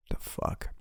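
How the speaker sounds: breathy whisper, barely audible; whispered disbelief. Retold or imitated. Overwhelmed seller staring at yesterday's order, whispered. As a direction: mutter it under your breath in disbelief. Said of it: "and I'm like".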